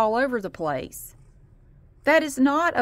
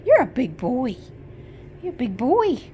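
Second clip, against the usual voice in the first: pet talk; 2 semitones higher, 4 dB louder than usual. Real, not acted. To her cat Marty, cooing baby-talk praise.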